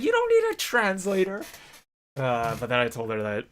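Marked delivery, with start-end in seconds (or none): 0.0-1.4 high, laughing mock complaint; playful accusation. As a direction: tease him in a squeaky voice. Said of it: "She's like".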